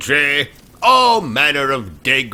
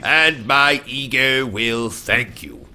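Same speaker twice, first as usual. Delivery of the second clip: put-on British accent.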